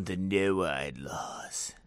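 low, drawn-out mock-ominous tone; hammed-up ominous reveal; mock conspiracy voice. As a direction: sink into a slow, spooky drone. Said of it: "every other conspiracy that I have to say like that".

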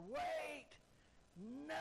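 high, urgent drawn-out shout; hollering from afar. Retold or imitated. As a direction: holler it out to halt everything. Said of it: "a man holler".

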